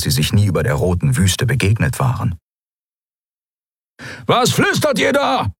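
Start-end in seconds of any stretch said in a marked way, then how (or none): 4.3-5.5 angry shout. A narrator's reading. Halbhorn to his whispering captives, angry shout.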